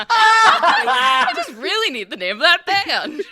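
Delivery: very high, loud, laughing wail; mock-desperate plea. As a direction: draw it out in hammed-up desperation.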